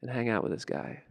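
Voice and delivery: soft, understated prompting; inner voice. Acted. Something inside urges him to stay with George, quiet inner voice. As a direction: say it quietly, as a private nudge. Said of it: "Something inside me said".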